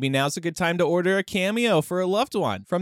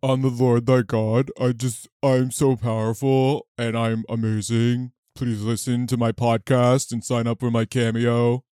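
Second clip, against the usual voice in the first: deep bro voice; 4 semitones lower than usual. Pretend. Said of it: "talk like you with the deeper voice".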